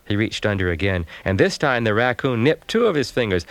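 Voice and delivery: plain reading voice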